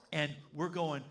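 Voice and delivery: normal talking voice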